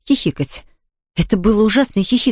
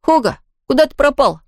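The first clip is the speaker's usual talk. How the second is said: calling out; 7 semitones higher than usual. A narrator's reading. Tom calls for missing ghost.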